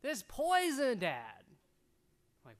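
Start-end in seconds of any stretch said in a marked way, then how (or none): 0.0-1.4 imitated son, loud and high. Son voiced in story.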